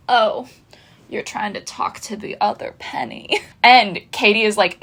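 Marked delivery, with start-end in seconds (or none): 0.1-3.4 hushed, husky whisper impression; Penny twenty-three. Redoing Penny twenty-three's line to Katie in a soft, whispery impression. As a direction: put on a very soft, whispery character voice. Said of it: "in my Penny twenty-three voice".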